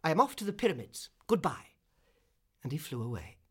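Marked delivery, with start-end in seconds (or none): 0.0-1.8 high, loud, fast parting shot; indignant cry. A narrator's reading. Swallow leaving the reed, crying out indignantly. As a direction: call it out and be off. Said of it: "he cried".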